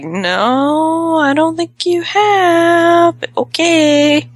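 high, slow, dismissive drawl; skeptical brush-off. Retold or imitated. Survivors humoring Harriet, high and dismissive. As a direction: brush off the claim with mock politeness. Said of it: "And they're like".

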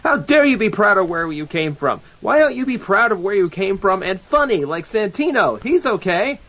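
high-pitched mock scolding; mock indignation. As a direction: scold in exaggerated, playful outrage. Mock scolding over national pride.

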